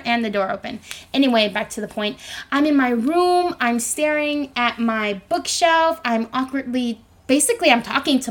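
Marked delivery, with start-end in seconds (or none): none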